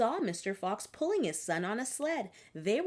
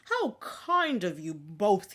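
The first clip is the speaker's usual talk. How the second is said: touched; 2 semitones lower, slower than usual. Acted. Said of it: "Tears came to Old Rabbit's eyes".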